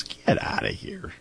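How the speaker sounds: breathy low get outta here, half-whispered; mock hushed disbelief. Retold colleague scoffing quietly at his claim. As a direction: scoff it under your breath. Friend doubting in retold story.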